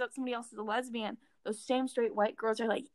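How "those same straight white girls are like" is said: ordinary talk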